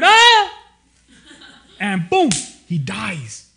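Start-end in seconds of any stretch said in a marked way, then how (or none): 0.0-0.6 sudden high loud mock scream, laughing; falling scream. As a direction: yelp like someone falling out a window. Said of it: "he probably heard".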